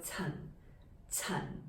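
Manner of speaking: Chan said low and falling. Shown as a demonstration. Teacher shows learners Cantonese Chan, low falling tone. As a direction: let "Chan" drop on a low tone. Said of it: "shows us it's low falling".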